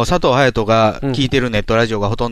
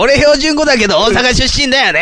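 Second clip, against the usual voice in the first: much higher, louder put-on Tokyo talk; Goro Yamada impression; 11 semitones higher, 4 dB louder than usual. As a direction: play a TV pundit in standard Japanese. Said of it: "山田五郎が出てくるね". (山田五郎風の高めで大きな標準語)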